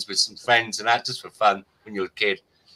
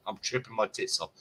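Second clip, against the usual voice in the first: repeated softer, dropped to an aside; quiet aside; 11 dB quieter than usual. Real, not acted. Telling friends a drug story, quietly repeated aside. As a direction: say it again under your breath as a confiding aside. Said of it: "And I'm tripping my tits off".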